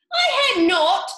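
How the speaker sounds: high, loud sharp squeak; angry mouse. Acted. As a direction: snap it back furiously as the mouse. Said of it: "cried the mouse sharply and very angrily".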